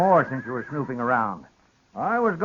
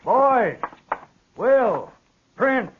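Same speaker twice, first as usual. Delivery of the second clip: calling out; 9 semitones higher, much slower than usual. Acted. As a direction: shout the names out to find them. Father calling sons from yard.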